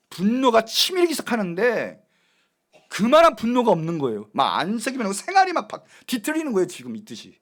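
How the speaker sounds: usual speaking voice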